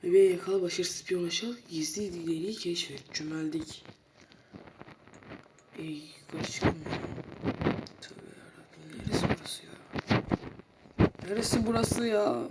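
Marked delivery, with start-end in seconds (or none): 0.6-9.7 hushed unvoiced whisper. Real, not acted. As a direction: whisper so nobody hears. Whispering objectives while sneaking. (fısıltıyla)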